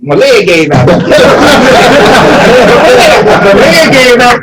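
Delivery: laughs it out high and loud, slowly; laughing. Real, not acted. Man laughing as he tells how his leg gave out in the fight. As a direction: laugh through the words.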